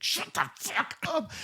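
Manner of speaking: high, slow, strained mock yell; hammed-up high-pitched exasperation; mock panicked hushing. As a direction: imitate a desperate shushing outburst. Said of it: "He's like".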